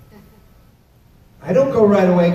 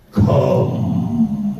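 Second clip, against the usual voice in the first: put-on much lower, eerie possessed child; creepy deep kid; 13 semitones lower than usual. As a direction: summon him like a horror-film child. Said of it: "Now his voice changes".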